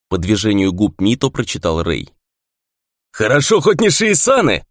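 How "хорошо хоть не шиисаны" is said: high, merry call; cheerful shout. A narrator's reading. Kato to fellow fighters as spirits approach, cheerful shout. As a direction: call out gladly, pitch up. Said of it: "весело прокричал Като".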